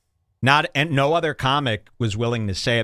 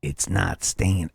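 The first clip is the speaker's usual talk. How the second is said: slow precious delivery; 6 semitones lower, slower than usual. Retold or imitated. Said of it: "where he goes".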